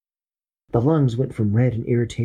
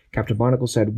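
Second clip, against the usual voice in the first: ordinary delivery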